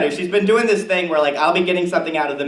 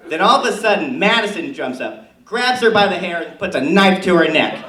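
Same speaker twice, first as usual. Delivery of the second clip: Tammy Kirscher character. Acted. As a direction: play a chatty suburban mom comic. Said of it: "please give it up for Tammy Kirscher".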